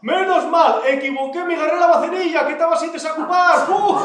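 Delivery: high, shouted comic outburst; loud exclaiming relief. Acted. Manolona tells the bishop of her mix-up, loud exclaiming relief. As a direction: blurt it out at full voice, very high.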